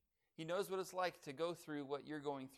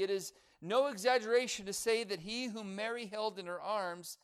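rising, louder sermon delivery; full-voiced preacher building the point; preaching; 5 semitones higher, 5 dB louder than usual. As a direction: proclaim it from the pulpit with force.

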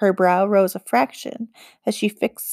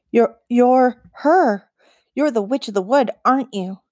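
stammering awe; 3 semitones higher than usual. Acted. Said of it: "Tin managed".

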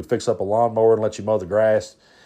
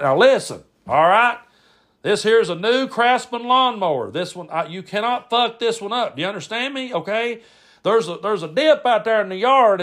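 Brad impression; 7 semitones higher than usual. Brad warns a kid about his new lawnmower, hectoring impression. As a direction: lay down the law in a higher, hectoring pitch. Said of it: "that's actually a decent impression".

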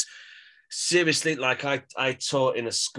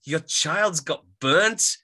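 confused surprise; 9 semitones higher, 4 dB louder than usual. Retold or imitated. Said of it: "And I was like".

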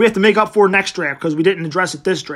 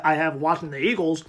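normal talking voice

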